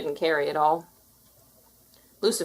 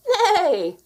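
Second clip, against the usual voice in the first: high, laughing horse neigh; whinny; 12 semitones higher, 6 dB louder than usual. A narrator's reading. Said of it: "whinnied Gus".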